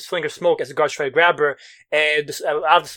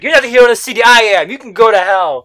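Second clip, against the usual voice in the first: loud, raised-pitch rant; angry defiance; 5 semitones higher, 10 dB louder than usual. Retold or imitated. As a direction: yell it at a rival hero. Acted quarrel between rival heroes.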